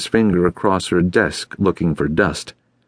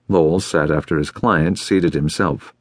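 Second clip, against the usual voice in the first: usual speaking voice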